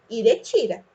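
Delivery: ordinary delivery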